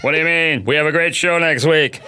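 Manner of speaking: low-register talk-show host impression; hint of a deep comic host voice; Jay Leno. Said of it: "I had to do that low J voice".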